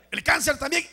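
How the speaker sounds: loud, high first statement; emphatic preacher's call, rising. Real, not acted. Each illness called, then repeated slowly.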